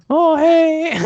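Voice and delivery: high, loud, jeering put-on British "Oh, hey!"; mock British. Mocking the British gamer everyone picked on, high loud mock British. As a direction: ham up a silly British accent to mock him. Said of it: "there was, like, a British person".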